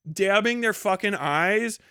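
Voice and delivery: usual speaking voice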